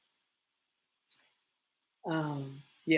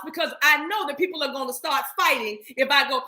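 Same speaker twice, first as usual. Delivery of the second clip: ordinary delivery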